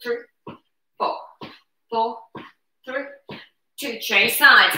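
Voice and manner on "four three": usual speaking voice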